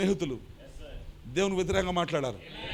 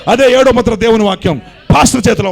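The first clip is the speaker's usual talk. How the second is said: loud, higher shout on pastor; preaching shout; 5 semitones higher, 15 dB louder than usual. Real, not acted. Pastor to congregation, shouting pastor చేతిలో ఉంది. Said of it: "repeated quietly after".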